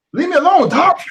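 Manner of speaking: high, loud mock scream at a dog; mock yell. Mimicking the YouTuber at her dog, high mock yell.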